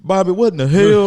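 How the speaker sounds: loud, high cartoon dad yell; King of the Hill impression. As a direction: shout it like Hank at Bobby. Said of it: "He was like".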